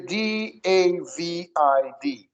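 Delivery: "D A V I D" spelled very slowly; slow spelling. Shown as a demonstration. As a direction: spell each letter slowly. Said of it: "Aí David".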